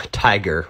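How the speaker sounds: "Tiger" with the final R sounded; hard R. Real, not acted. Player laughing at his slip, "Tiger" with a hard R. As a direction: end "Tiger" on a firm R. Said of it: "Hard R there".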